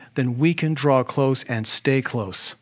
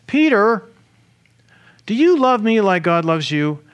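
high, louder, pressing question; insistent calling; 7 semitones higher, 5 dB louder than usual. Acted. Jesus asking Peter about his love, high and insistent. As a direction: call out the name, then ask it insistently.

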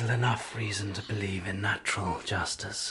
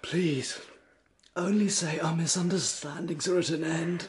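earnest plea; 5 semitones higher, 4 dB louder than usual. Acted. Husband begs for reconciliation.

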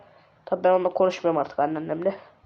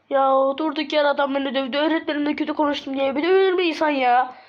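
higher-pitched, plaintive whine; self-pitying complaint; 5 semitones higher than usual. Acted. Beaten son complains to himself, high plaintive whine. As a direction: whine it as an aggrieved victim.

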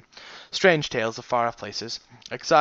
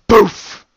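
boof sound effect; shouted thump noise; loud impact sound; 8 semitones higher, 14 dB louder than usual. A narrator's reading.

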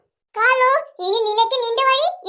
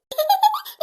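high giggle; 8 semitones higher than usual. Acted. Relieved laugh.

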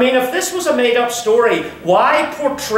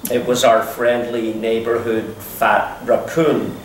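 ordinary talk, nothing marked

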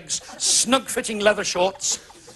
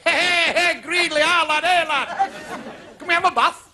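mock northerner calling; 9 semitones higher than usual. Northerners knocking at the southern door, hammed-up call. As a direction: shout through the door like a cheeky northerner. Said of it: "we used to knock on the door and say".

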